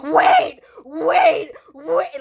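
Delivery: loud, high, frantic screams of wait; retold screaming. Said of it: "he was screaming bloody murder".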